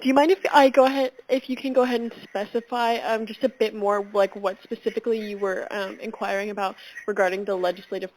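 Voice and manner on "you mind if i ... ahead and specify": louder, with a small laugh; speaking up. Real, not acted. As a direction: push the voice through the phone. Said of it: "And you're gonna have to speak up again".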